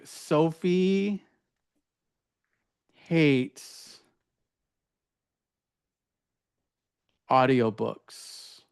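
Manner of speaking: very slow, with long gaps between words; writing aloud. Shown as a demonstration. Modeling a blunder as it's written, very slowly. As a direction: dictate to yourself, word by word. Saying words as she types them.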